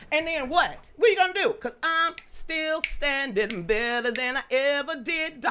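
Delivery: defiant. Real, not acted. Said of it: "defiant to her critics".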